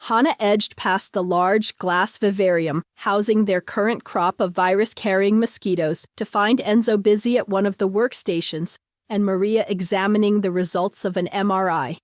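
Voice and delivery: ordinary delivery, nothing marked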